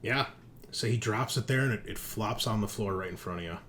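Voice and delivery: normal talking voice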